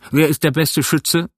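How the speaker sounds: brisk sea captain asking; commanding captain voice; ship captain. A narrator's reading. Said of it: "fragte der Kapitän".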